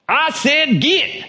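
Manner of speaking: high, loud, drawn-out Texan command; Texan exorcist cowboy. Acted. Texas exorcist commanding the possessed girl, booming cowboy order. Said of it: "the Texorcist with his big ten-gallon hat".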